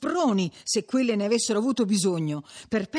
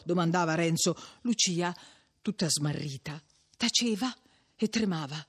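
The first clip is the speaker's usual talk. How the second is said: ordinary delivery, nothing marked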